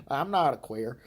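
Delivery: ordinary delivery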